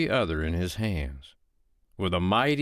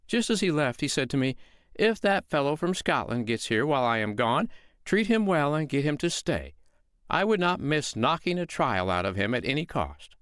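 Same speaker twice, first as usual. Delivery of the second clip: mild, higher, innocent housewife voice; sweet-voiced woman; 7 semitones higher than usual. Foster mother calmly lying to the giant, innocent tone. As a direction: play the calm mother who is fooling the giant.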